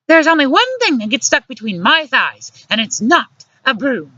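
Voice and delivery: high-pitched sassy woman; indignant comeback. A narrator's reading. Luciana answers the broom jibe, indignant comeback. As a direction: fire back in a high, pointed voice.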